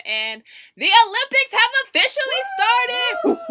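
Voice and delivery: high, loud cheering announcement; yelling with Olympic excitement; excited shout. Real, not acted. Host cheering the Olympics opening to listeners, excited shout.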